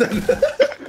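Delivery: high-pitched cracking-up laugh; helpless laughter. Real, not acted. Gamer cracking up at his own excuse, helpless laughter. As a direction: burst out laughing.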